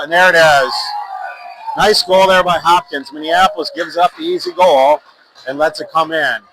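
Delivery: real excitement as the home team scores; goal call. Real, not acted. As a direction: ride the rush as the puck goes in. Goal scored, commentator erupts.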